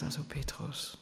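hushed, breathless aside; a soft unvoiced whisper of mild reproach. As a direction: lean in and whisper his name. Imagined Jesus gently chiding Peter.

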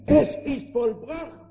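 lifted high and drawn out; slow, high confirming cry; high ringing echo. Acted. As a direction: sound like an answering echo from above.

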